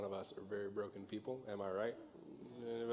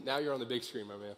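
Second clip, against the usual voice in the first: normal talking voice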